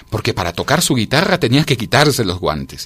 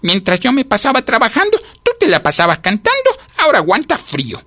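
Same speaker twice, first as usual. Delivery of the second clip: high, scolding tirade; storybook ant telling off a singer, pitched up; indignant rebuke; 13 semitones higher, 4 dB louder than usual. A narrator's reading. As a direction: lecture the idler with outrage. Ant rebukes the idle cicada.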